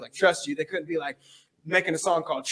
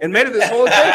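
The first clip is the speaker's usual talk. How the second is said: ordinary talk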